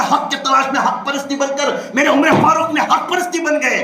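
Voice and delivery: high ringing questions, forceful answers; impassioned preaching. Real, not acted. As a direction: fire questions at the crowd and answer them.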